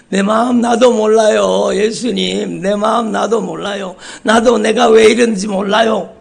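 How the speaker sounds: high, loud anguished cry to Jesus. Retold or imitated. Pastor voicing a weeping believer's plea, high and loud. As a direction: cry it out, helpless and pleading. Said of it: "눈물을 뚝뚝 흘리면서 얘기하는".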